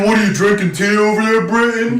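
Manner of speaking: high, loud teasing jab at Britain; mock taunt. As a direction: needle them in a high, loud, cheeky voice.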